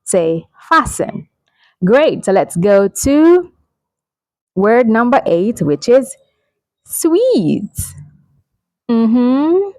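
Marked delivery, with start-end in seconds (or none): 0.7-1.3 "fasten" as FASS-en, no t; careful repeat of "fasten" for learners; silent T. Shown as a demonstration. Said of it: "The letter T here is silent". 6.8-7.7 "sweet" as sweet, high then falling; long ee and w glide in "sweet"; high, clear model "sweet" for suite; correct pronunciation. Shown as a demonstration. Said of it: "It has the same pronunciation as".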